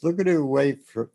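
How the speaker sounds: ordinary talk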